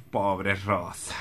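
a soft, slow echo of pity; muttered pity. Acted. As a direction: say it almost to yourself. Repeats the lament under his breath.

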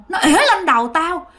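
high-pitched indignant ghost complaint; aggrieved spirit. Retold or imitated. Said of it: "Nói vậy đó các bạn". (giọng vong oán trách, cao)

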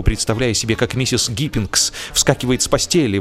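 ordinary delivery

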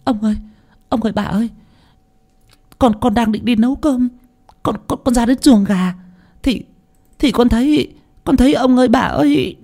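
put-on high stammering servant girl, shaken; scared young maid blurting to her masters; frightened maid. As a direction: stutter it out in panic, voice up high.

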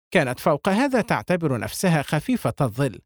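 ordinary delivery

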